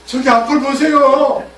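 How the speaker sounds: high, loud and slow plea; pleading donkey. Acted. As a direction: urge him slowly to look ahead. Donkey points to the angel.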